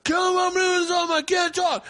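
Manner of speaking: very high, strained mock shout; yelling over noise. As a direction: holler over the chainsaw, imitating him. Retold Zach shouting over chainsaw.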